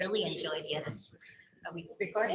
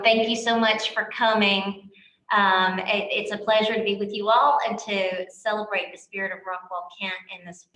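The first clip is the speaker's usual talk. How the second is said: normal talking voice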